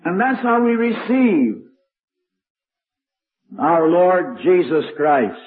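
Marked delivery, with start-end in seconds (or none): none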